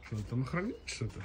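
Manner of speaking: ordinary talk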